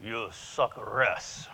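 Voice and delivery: lightly voiced Japanese accent, very slow; a hint of Japanese-accented English, drawn-out advice; Yasu's Japanese accent. Friend slowly advising the speaker, light Japanese accent. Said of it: "So I'm gonna do Yasu's voice".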